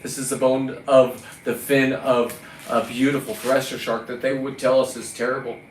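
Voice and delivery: usual speaking voice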